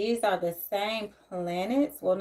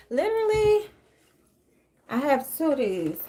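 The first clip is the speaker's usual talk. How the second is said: exclaimed high; 6 semitones higher, 6 dB louder than usual. Real, not acted. Amused at having two books.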